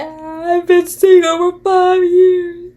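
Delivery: high, slow, sobbing mock voice as if in tears; hammed-up weepy girl on camera, half laughing; a parody of a tearful reality-show confession; mock crying contestant. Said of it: "it's like".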